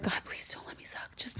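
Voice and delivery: hushed, mock-desperate prayer; whispered plea. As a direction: beg God under your breath, playfully. Retold desperate prayer before filming.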